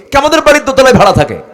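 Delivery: loud, high, fast comic answer; mock eager boy. Boy in the audience answers about Omar, mock eager shout. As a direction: blurt it out like a cocky know-it-all. Boy's answer acted for a joke.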